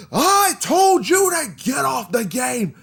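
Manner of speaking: high, drawn-out furious yell; screaming stepdad. Retold or imitated. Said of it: "just screaming at me like".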